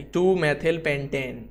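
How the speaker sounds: टू methyl pentan spelled out word by word, very slowly; slow classroom dictation of the name. Shown as a demonstration. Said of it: "teacher repeats name slowly".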